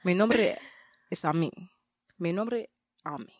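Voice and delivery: Spanish self-intro, lightly sexy; low sexy Spanish. Pretend. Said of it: "introduce yourself in Spanish".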